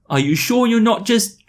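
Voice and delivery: high-pitched, hesitant doubting question; sceptical question. Acted. British doubting she is pregnant, high and hesitant. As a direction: voice climbs high, pause before the last word.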